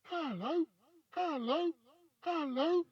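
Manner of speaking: high parrot voice from across the hall; high, far-off parrot greeting; parrot calling. A narrator's reading. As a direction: call as a distant parrot, rising each time. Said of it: "came the voice again".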